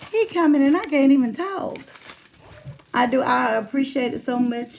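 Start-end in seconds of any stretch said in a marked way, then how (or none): 0.1-1.8 louder, higher, rushed excitement; blurting it out, too thrilled to talk; retelling an excited outburst, loud. Retold or imitated. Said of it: "and I was like".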